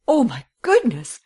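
high, loud exclamation; shocked cry. A narrator's reading. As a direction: cry out in dismay. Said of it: "she cried".

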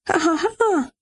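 hohes, fremd klingendes Lachen; lachen, obwohl sie es nicht will; hysterisches Lachen. Acted. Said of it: "Zu dumm, dass ich lache". (hohes, unfreiwilliges Lachen)

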